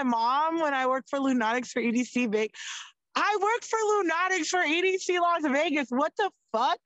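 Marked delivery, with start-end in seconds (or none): 3.1-6.8 high, bright-eyed brag to mom; thrilled outburst. Retold or imitated. Telling her mom about the Lunatics job, excited. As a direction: blurt it out, over the moon.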